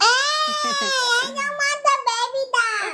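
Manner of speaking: high, drawn-out tearful whine; real crying protest. Real, not acted. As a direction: wail in protest, stretching the words. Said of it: "why are you crying?".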